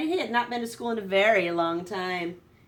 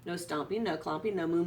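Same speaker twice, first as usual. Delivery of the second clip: ordinary delivery, nothing marked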